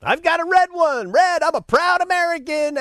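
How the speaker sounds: high-pitched parody of a proud patriot; mock proud boasting. Green-line flag owner showing off, mock proud.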